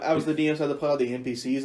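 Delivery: ordinary talk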